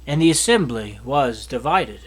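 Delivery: ordinary delivery, nothing marked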